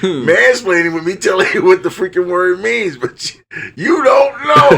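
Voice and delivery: high-pitched rant climbing into a shout; mock indignation. Ranting at ex-girlfriend who didn't know the word, mock indignant. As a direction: build to a wailing, exasperated mock yell.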